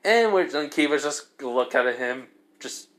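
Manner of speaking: usual speaking voice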